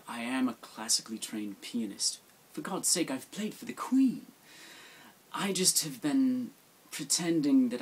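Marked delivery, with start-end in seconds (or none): 0.1-4.3 posh British. Acted. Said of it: "Well, truthfully".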